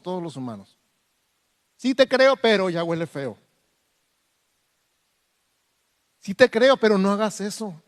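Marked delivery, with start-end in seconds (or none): 1.8-7.8 creyente dudoso. Retold or imitated. Imitating doubting believer.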